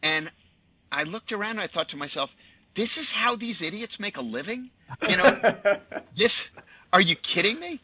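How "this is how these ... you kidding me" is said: high-pitched mock disbelief, breaking into laughter; incredulous. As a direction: replay your astonished scoffing, pitched way up. Retelling his disbelief at film crew.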